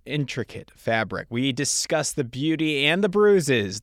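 loud, punchy promo read; podcast intro read. Acted. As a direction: sell the guest with big, emphatic phrasing.

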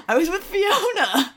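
giggling through it; laughing. Real, not acted. Laughing confession.

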